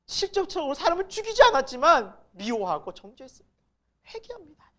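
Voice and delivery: high-pitched pleading confession, swelling and dropping away; penitent prayer. Acted. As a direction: confess to the Lord in anguished remorse. Acted prayer of repentance to God. (주님께 회개하며 애절하게 고백하듯)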